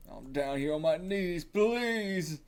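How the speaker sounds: begging, pitch climbing on please; pleading. Acted. Apologizing to both on bended knee, rising plea. Voices character begging on knees.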